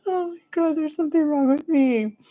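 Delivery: high-pitched, helpless after a laughing fit; laughing to tears. Real, not acted. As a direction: squeak it out, weak from laughing. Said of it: "they're making me cry".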